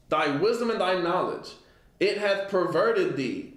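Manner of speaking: measured, solemn scripture reading; a preacher reading from the pulpit. Acted. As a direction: read the verse like a preacher, slowed. Reads scripture aloud.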